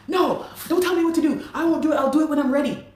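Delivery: high, loud hammed-up snap with a laugh behind it; mock angry refusal. The singer mocking his manager refusing to fix the permit, loud and high.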